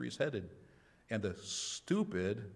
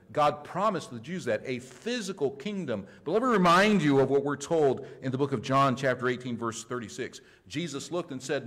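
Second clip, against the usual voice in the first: raised, emphatic sermon delivery; forceful preaching; 3 semitones higher, 6 dB louder than usual. Real, not acted. Preacher correcting beliefs about the kingdom, forceful preaching. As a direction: drive each point home to the congregation.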